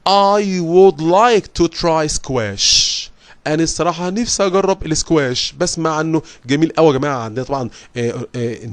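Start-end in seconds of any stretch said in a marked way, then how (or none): 0.1-3.0 very slow, careful reading; slow English reading voice. Acted. Teacher reading the girl's answer aloud for students. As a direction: spell the answer out slowly for students.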